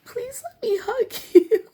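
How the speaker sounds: put-on very high, whiny toddler begging; pleading child voice. Begging annoyed kids for a hug, high whiny plea. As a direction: plead like a little kid near tears. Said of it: "'Cause I'm like".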